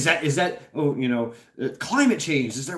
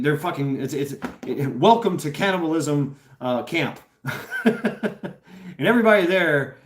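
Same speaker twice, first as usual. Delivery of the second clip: ordinary talk, nothing marked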